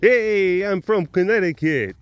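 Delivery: brash Northeast Connecticut voice; Connecticut attitude. Retold or imitated. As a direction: tough Connecticut tourist-wrangler. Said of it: "Bringing some of that Connecticut attitude".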